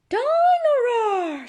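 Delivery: put-on very high, loud and slow toddler squeal; little George voice. Little brother naming his new toy, high slow squeal. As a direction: stretch the name like a thrilled little piglet.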